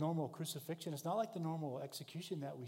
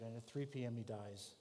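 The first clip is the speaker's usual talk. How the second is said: solemn hush; 4 semitones lower, 6 dB quieter than usual. Acted. Solemn death moment.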